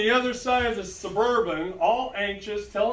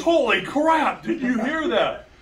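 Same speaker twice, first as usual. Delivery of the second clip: excited exclamation; 5 semitones higher, 4 dB louder than usual. Retold or imitated. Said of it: "He goes".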